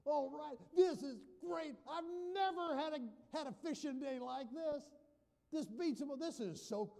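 high, loud, whooping celebration; hammed-up jubilant shouting; happy-dance elation. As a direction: crow with delight over the catch of a lifetime. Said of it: "doing the happy dance".

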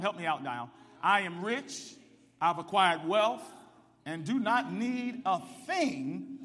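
loud, rising, call-and-response preaching; preacher calling the congregation to answer, loud; full-force preaching. Acted. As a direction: drive the scripture home to the church.

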